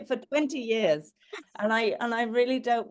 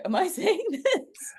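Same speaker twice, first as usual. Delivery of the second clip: bewildered audience member; 10 semitones higher, slower than usual. Retold or imitated. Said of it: "they're looking at me like".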